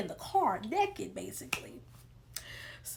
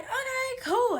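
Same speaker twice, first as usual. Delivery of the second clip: high, loud eager "okay, cool"; 8 dB louder, slower than usual. Retold or imitated. Telling friends she's got the lookout, eager and high. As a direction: jump at the job, overly keen.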